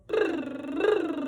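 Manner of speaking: slow buzzing brrr; a lip-trill brrr. Shown as a demonstration. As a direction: trill the lips loosely. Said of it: "the lip buzz".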